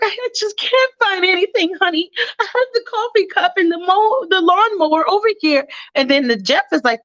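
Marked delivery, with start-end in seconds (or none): 0.0-5.7 high, flustered mother voice; a confused mom fumbling for her things; mock confused mother. Mom figure in retold skit.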